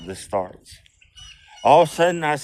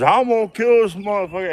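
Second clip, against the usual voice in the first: quoted threat; 8 semitones higher, faster than usual. Retold or imitated. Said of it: "And the guy says".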